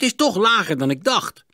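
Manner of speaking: hoog uitgeroepen na een stoot; verongelijkte uitroep. A narrator's reading. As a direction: klaag hoog, alsof je je hoofd stootte. Roept na het stoten. (verbaasd en verongelijkt, hoog)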